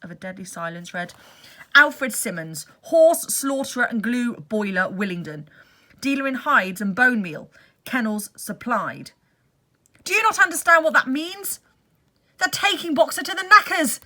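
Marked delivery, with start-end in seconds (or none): 1.7-9.1 loud, deliberate reading aloud; reading out the sign. Acted. Benjamin reading the van's lettering, loud deliberate reading. 10.0-14.0 loud high desperate shout; horrified shout. Acted. Benjamin explaining the knacker's van, desperate shout.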